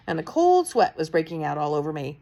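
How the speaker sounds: plain reading voice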